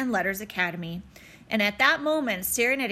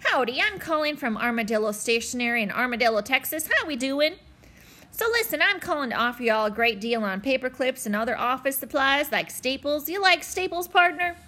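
Texas salesman; 2 semitones higher than usual. Acted. Texas stationery salesman on phone.

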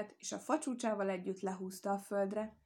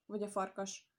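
ordinary delivery

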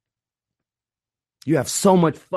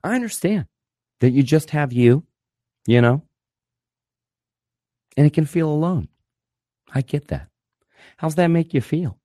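mock therapist. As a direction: play the understanding counselor, a touch too gentle. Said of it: "But they'll tell you that".

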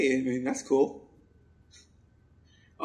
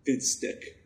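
whispered; 5 dB quieter than usual. Pretend. Trying out a new name.

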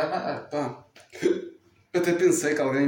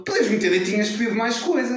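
higher, louder scolding; loud complaint; 9 semitones higher, 4 dB louder than usual. Retold or imitated. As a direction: complain loudly for everyone to hear. Mãe a falar alto. (mãe a reclamar alto, voz aguda)

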